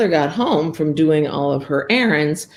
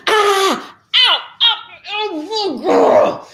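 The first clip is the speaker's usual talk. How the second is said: high loud ow ow yelps; pained yelps; 5 dB louder, slower than usual. Acted. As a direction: yelp at each needle jab. Ogre steps on needles.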